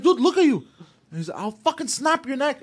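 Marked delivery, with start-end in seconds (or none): 0.0-0.6 mocking shout. Squaring up to the guy. 1.4-2.6 high, slow yelled threat; mock yell of a neck threat; retold threat scream. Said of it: "I screamed this out really loud".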